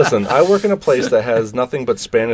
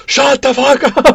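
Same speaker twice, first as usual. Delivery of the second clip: high, loud exasperated outburst; mock angry yell; 12 semitones higher, 6 dB louder than usual. Wolverine snapping at the Spanish-speaking girl, mock furious shout. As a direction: yell it as an impression of someone at wit's end.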